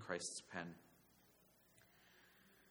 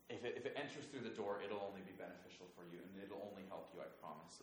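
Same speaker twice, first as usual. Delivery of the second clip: soft reassurance; 9 dB quieter, faster than usual. Acted. Shepherd promising the door will only help, hushed. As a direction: reassure them very softly.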